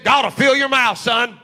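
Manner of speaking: loud preacherly father booming; father shouting reassurance at his nervous son; dad's shout. Retold or imitated. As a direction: proclaim it like a confident father. Dad's firm encouragement, shouted.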